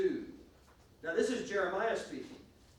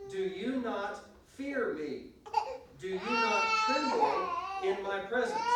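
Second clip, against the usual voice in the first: scripture reading; 4 dB louder than usual. Acted. Reads scripture aloud.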